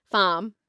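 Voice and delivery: FARM with the R dropped, Australian style; Australian non-rhotic. Retold or imitated. Imitating an Australian colleague, R dropped. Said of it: "and just sound Australian".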